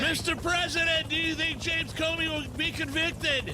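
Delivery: high-pitched shouted press question; hammed-up reporter hollering to be heard; mock reporter shouting. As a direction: yell it over a helicopter like a reporter. Nobody can hear anything.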